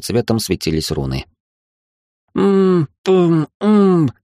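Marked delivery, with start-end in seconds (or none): 2.3-4.1 mumbling through a gag; muffled moans through a gag; muffled by hand. A narrator's reading. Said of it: "промычала она что-то нечленораздельное сквозь кляп".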